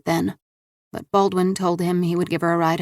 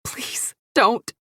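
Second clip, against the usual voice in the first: begging, voice cracking; 5 semitones higher than usual. Acted. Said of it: "she begged, her voice cracking".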